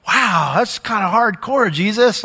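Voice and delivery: slow, mildly startled "wow"; taken-aback reaction. Retold or imitated. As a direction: react like a reader a bit shocked. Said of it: "you kinda go".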